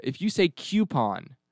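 coupon said as KYOO-pon; disliked pronunciation. Shown as a demonstration. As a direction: coupon with a y-glide, slow "kyoo". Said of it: "instead of coupon".

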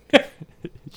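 loud, high burst of laughter; a real squeal of a laugh. Real, not acted. Co-host cracking up at the joke, high loud laugh.

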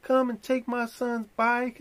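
high mock Karen question; mock accusing lady. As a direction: accuse him like the neighbor lady. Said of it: "confronting me and saying".

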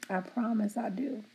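normal talking voice

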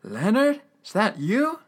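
imitated puzzled old man. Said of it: "the old man first confuses Eugene".

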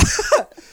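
sudden high-pitched laugh; high burst of laughter. Real, not acted. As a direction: let a squeaky laugh burst out. Laughing at joke.

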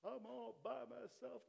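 whiny self-pity. Retold or imitated. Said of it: "No, you not".

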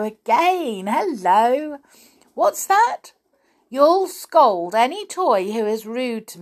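high, showy phone manner; doll pretending on telephone. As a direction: thank an imagined ally for others to overhear.

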